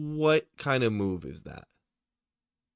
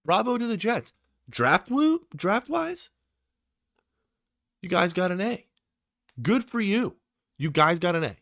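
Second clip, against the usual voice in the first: much higher and louder, cheering the pick; excited praise; 9 semitones higher, 5 dB louder than usual. Real, not acted. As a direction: hype them up like a fan celebrating. Praising the Jets' draft pick.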